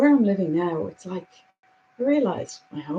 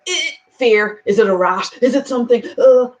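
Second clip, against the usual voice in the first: startled, louder mock jitters; mock fear; 3 semitones higher, 11 dB louder than usual. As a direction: imitate a jumpy fright at a noise. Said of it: "my dial went to".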